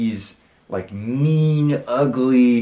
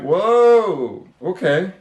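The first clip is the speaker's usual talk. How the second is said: higher, louder retold whoa of shock; startled whoa; 5 semitones higher, 5 dB louder, much slower than usual. Dreamer landing in the produce section, startled whoa. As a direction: jolt out the whoa after being flung. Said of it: "And I'm like".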